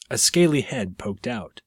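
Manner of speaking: usual speaking voice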